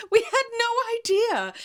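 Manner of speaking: very high words through laughter; laughing too hard to talk normally. Real, not acted. Laughing at the coincidence.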